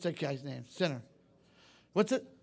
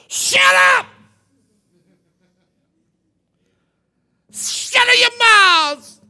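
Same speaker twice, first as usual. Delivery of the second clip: shout; 12 semitones higher, 15 dB louder, much slower than usual. Retold or imitated. Caleb shouting the crowd down, very loud and high. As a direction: yell it at full force.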